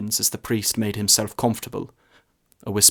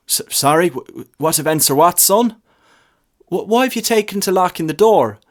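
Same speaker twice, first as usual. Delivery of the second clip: put-on high, louder stammering young voice; stammering lad; 8 semitones higher, 6 dB louder than usual. A narrator's reading. Lad anxiously asking why the church door is locked. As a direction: trip over the words, anxious.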